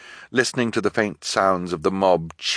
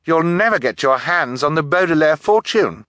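high, raised interjection; anxious, pitched-up warning; urgent girl; 9 semitones higher, 5 dB louder than usual. A narrator's reading. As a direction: jump in to reason with the captor. Girl argues urgently with captor.

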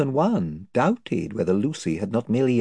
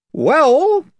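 higher, blustering chief; invisible chief; 10 dB louder than usual. Acted. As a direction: boast of his band's numbers.

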